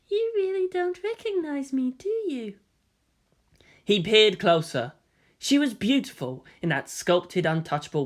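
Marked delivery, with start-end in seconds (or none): 0.1-2.6 high, teasing and knowing; a coy high-voiced tease; lilting playful lady; coy feminine voice. A narrator's reading. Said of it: "She giggled, elegantly".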